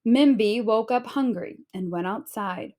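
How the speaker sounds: usual speaking voice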